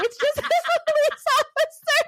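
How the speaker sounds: high, giggling protest; laughing excuse. Retold or imitated. She retells explaining to her husband, laughing helplessly. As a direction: crack up while imitating your guilty self.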